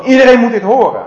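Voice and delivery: high loud proclamation; calling out. Retold or imitated. Rabbi calling out to everyone, loud high shout. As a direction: announce it to everyone. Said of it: "Zo van".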